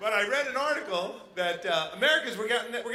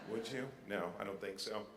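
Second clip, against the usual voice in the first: quiet; 11 semitones lower, 15 dB quieter than usual. Real, not acted. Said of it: "quiet aside".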